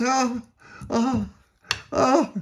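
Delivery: short high "oh" cries; acted effort cries. A narrator's reading. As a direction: cry out on each step down. Climbing down the mast.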